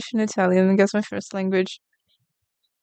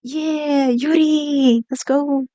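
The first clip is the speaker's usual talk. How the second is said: higher, bright little cartoon critter; cheery raccoon voice; 6 semitones higher, 3 dB louder, much slower than usual. Raccoon sidekick cheering on Yuri, higher cartoon voice. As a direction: give the drawn raccoon a peppy sidekick voice. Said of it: "the raccoon is like".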